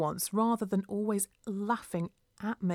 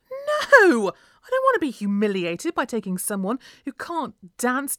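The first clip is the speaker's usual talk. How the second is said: loud, high "No", easing into scorn; scornful refusal; 5 semitones higher, 8 dB louder than usual. A narrator's reading. Mum refusing to take daughter to dance class, scornful. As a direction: shoot the request down loudly.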